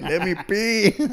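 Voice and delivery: high, slow, drawn-out whiny begging; whiny plea. Retold or imitated. Arrested teen to the cops, high slow whiny plea. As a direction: stretch it out like a desperate kid. Kicking the wagon, begging to pee.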